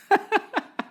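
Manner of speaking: a sudden high cackle at the memory; high burst of laughter. Real, not acted. Telling a set story, breaks into high laughter.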